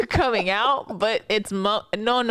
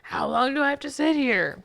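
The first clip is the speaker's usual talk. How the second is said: whiny patient; 5 semitones higher than usual. Retold or imitated. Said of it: "patient voiced by coworker".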